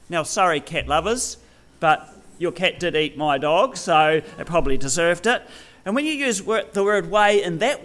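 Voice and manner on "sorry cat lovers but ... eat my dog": ordinary talk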